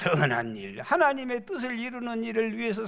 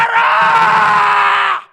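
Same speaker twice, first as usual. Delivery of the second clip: high, slow, drawn-out war cry; battle shout; 13 semitones higher, 13 dB louder, much slower than usual. Shown as a demonstration. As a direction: roar the attack order. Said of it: "징겨가라 한번 소리 질러봐".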